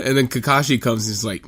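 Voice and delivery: normal talking voice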